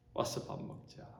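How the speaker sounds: whispered, tender call to eat; whispered invitation. Acted. Jesus voiced, hushed. (속삭이듯 부드러운 초대)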